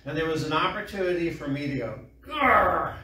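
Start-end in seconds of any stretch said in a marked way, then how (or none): 2.3-2.9 loud, higher-pitched comic growl; mock angry growl. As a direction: growl as if losing your temper. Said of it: "To get angry".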